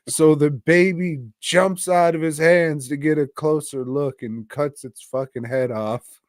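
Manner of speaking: ordinary delivery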